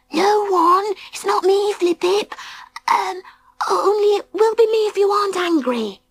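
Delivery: very high, small pleading voice; high and timid, a small creature; little beaver voice. A narrator's reading. Baby Bee's nervous reply.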